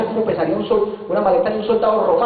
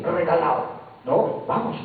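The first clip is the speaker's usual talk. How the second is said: ordinary delivery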